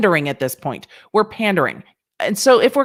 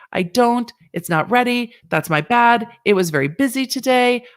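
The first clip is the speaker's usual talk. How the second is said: ordinary talk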